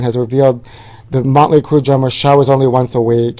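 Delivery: ordinary delivery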